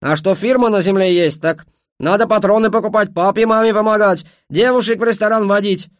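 high, loud self-justification; indignant shouting. A narrator's reading. Ashot defends himself to Khmel, shouting indignantly. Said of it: "закричал он".

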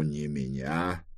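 slow, hushed emphasis; soft insistence. Acted. Trevize asks Bliss to pick someone else, soft insistence. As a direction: say it low and drawn out.

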